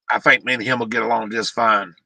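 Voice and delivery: sarcastic. Real, not acted. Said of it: "Yeah, that's sarcasm".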